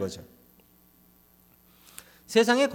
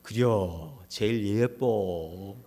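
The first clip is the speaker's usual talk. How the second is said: low, very slow Chungcheong drawl; Chungcheong dialect husband; 8 semitones lower, 4 dB quieter, much slower than usual. Retold or imitated. 아내에게 답하는 충청도 남편, 아주 느린 사투리. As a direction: stretch it out lazily like a Chungcheong husband. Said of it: "충청도는".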